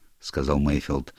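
plain reading voice

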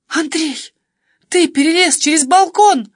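high, loud gasp of alarm; frightened. A narrator's reading. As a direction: exclaim in shocked fright. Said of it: "испугалась я".

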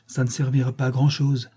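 ordinary delivery

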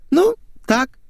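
very high, small hedge; evasive girlish voice. A narrator's reading. Girl dodges her friend's question, high little voice.

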